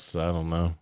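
usual speaking voice

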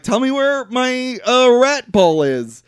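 high, slow mock demand; imitating an insistent brother; retold demand.

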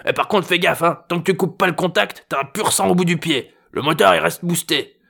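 high grandfather voice, cautioning; warning old man. A narrator's reading. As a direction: warn him, old-timer style.

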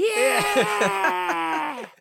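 very high, loud cheering yell; rally shout. Retold or imitated. Rally leader's closing cheer, a loud high yell. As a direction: roar it to the bleachers.

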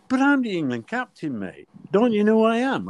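high-pitched pleading outrage; indignant protest. Retold or imitated. Captain facing a yellow card, indignant protest.

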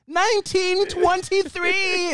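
very slow, high-pitched year; high drawn-out mock swoon. Joking as Grams remembering a sock hop, drawn-out swoon. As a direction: stretch it out high, mock-nostalgic. Riffing as smitten old Grams.

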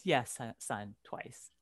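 low, dropping imitation of a dad; retold dad's hushed confession; quiet fatherly admission. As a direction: trail down low and soft as the admitting father. Voices father's sheepish answer to son.

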